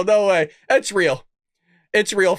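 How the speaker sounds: ordinary delivery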